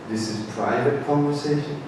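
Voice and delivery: soft, hushed repeat; quiet private tone. Shown as a demonstration. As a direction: say it again just as softly. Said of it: "talking like this".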